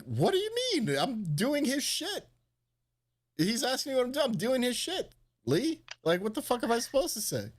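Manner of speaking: high, incredulous whine; high-pitched mock disbelief; baffled protest. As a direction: play it like you can't believe the question. Retelling own confused protest.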